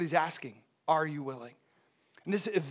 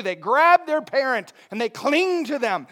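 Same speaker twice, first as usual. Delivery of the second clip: ordinary talk, nothing marked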